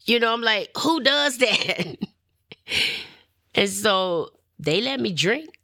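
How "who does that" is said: high, drawn-out disbelieving question; incredulous. Retold or imitated. Woman recalling being told to go to church, incredulous. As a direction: stretch it out in amused disbelief. Retold disbelief, repeated punchline.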